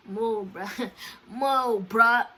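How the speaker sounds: retold irritated laugh, telling someone to back off; pissed-off laughing. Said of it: "I'm laughing 'cause I'm getting pissed".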